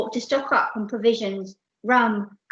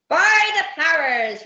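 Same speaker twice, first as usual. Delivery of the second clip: loud, raised pirate roar; 7 semitones higher, 8 dB louder than usual. Acted. Captain to Percy at the chest, roaring. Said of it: "roared Captain Abdul".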